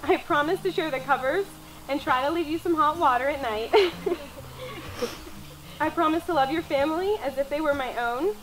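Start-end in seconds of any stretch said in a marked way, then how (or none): 3.8-5.8 soft laugh. Real, not acted. Joke about hot water.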